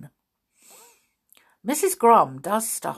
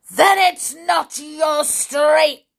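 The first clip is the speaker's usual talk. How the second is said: loud, higher, slow furious shout; 9 semitones higher, 7 dB louder, slower than usual. A narrator's reading. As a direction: shout it right in her face. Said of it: "shouted right in the little girl's face".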